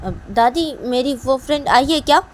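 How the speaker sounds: high, loud, eager question; asking child voice. Acted. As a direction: ask grandma like an eager kid. Child asking grandmother about friend.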